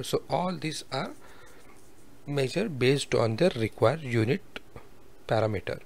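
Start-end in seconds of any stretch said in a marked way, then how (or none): none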